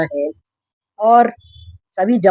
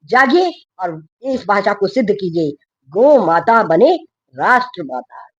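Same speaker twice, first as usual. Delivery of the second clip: ordinary talk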